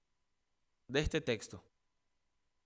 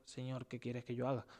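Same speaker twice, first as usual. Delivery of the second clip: hushed, much softer humble plea; trembling and fearful; 4 semitones lower, 10 dB quieter than usual. Retold or imitated. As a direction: say it softly like a frightened man surrendering. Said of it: "temblando y temeroso dijo". (ruego suave y temeroso, mucho más bajo)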